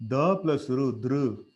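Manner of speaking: "Da" and "ru" joined into "dru"; da plus ru. Shown as a demonstration. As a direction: build "dru" out of da and ru. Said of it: "pronounce that".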